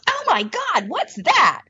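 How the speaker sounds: high, excited "Oh my God"; a stranger lighting up at the sight; thrilled gasp. Retold or imitated. Little woman beside her spotting the bowl, high thrilled exclamation.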